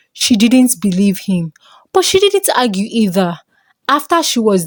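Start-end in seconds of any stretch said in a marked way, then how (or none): none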